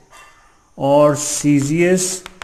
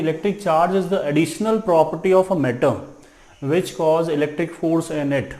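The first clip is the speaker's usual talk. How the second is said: slow dictation; much slower than usual. Shown as a demonstration. Dictating the definition.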